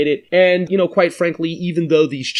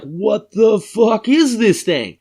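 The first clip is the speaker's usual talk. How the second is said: slow stunned disbelief, higher; freaked out; 5 semitones higher, 3 dB louder, slower than usual. Retold or imitated. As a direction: gasp it out like a spooked kid. Kids freaked out by discovery.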